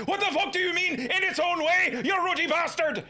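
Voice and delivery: high, loud, outraged yell; indignant outburst. Acted. Irish investigator offended by Toussaint's remark, outraged shout. Character's outburst at backhanded compliment.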